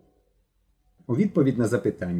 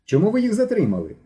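alarmed question; 10 semitones higher than usual. Acted. Boy questions policeman, alarmed.

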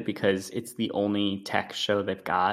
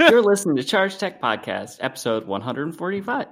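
podcast intro; 5 semitones higher, 4 dB louder than usual. Acted. Recording the show intro.

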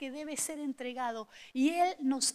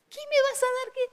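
much higher, startled asking; alarmed question; 12 semitones higher, 5 dB louder than usual. Retold or imitated. Patient startled by the doctor's injection, alarmed. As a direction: jump at the strange drug name.

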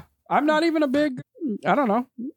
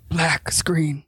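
whispered aside. Real, not acted. Co-host describes the Sopranos' ending, whispered. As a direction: whisper it, all breath.

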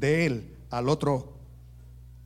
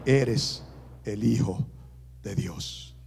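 awed confession; 4 semitones lower than usual. Acted. Disciples worship Jesus, hushed and in awe. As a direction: say it in hushed wonder.

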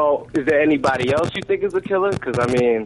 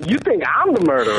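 shouted, higher, playfully wounded; mock outrage; 8 semitones higher than usual. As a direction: protest your innocence in a hammed-up cry. Accused of being the killer.

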